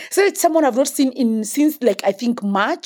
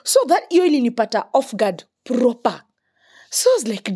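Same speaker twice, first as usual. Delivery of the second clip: ordinary delivery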